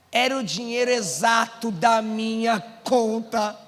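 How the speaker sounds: drawn-out, word by word reveal; slow, deliberate. Real, not acted. Preacher revealing the exact money, slow and deliberate. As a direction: let each word hang, stretched to the end. First, measured telling before the shout.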